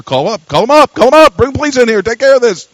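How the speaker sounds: loud, urgent acted call for help; mock alarmed outcry. Churchgoers seeing a girl abuse her mother, mock alarm. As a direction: raise pitch and volume like an alarmed onlooker. Congregation alarmed, calling for police.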